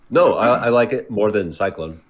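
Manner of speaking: mouth full. Real, not acted. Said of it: "I have ice in my mouth".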